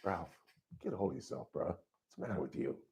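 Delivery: under-the-breath self-talk after an outburst; talking himself down. Retold or imitated. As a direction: quietly tell yourself to get a grip. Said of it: "And then I realized".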